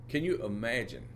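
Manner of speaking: ordinary talk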